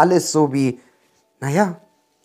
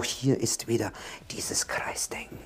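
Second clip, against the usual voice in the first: whisper; 6 dB quieter than usual. Real, not acted. Drops to whisper mid-explanation.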